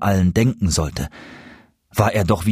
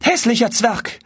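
high-pitched recoiling outcry; horrified cry; 14 semitones higher than usual. A narrator's reading. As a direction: cry out in high-voiced revulsion at the stranger. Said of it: "fuhr aber mit einem Schrei des Entsetzens zurück". (entsetzter, hoher Aufschrei)

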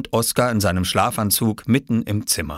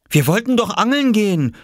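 put-on high, loud child's complaint; reproachful; 14 semitones higher, 5 dB louder than usual. Oskar finding Viktor still in bed, reproachful. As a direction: complain as a let-down small boy. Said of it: "sagte Oskar vorwurfsvoll".

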